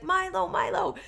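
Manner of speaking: mock calling out for the dog, high and loud; retold yelling. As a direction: shout the dog's name up the stairs, high and loud. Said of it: "I could hear him yelling for Milo".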